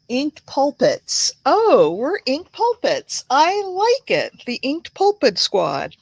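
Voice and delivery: high, bright-eyed delight at a new name; light up at the nickname, pitch jumping up. Real, not acted. Reading a viewer's group name, delighted.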